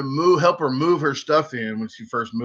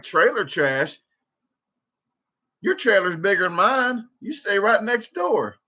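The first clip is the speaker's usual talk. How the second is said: incredulous comeback; 6 semitones higher than usual. Retold or imitated.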